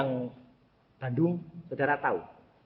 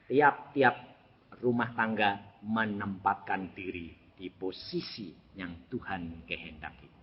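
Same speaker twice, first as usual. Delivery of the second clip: normal talking voice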